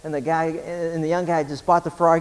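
ordinary talk

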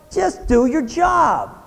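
slow shouted rebuke; stern shout. Real, not acted. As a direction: drive each word home. Preacher scolding athletes.

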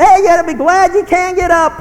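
high, loud scolding retort; loud rebuke. Acted. Preacher to someone moaning about getting up, loud rebuke. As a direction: cut in loudly on the moaner.

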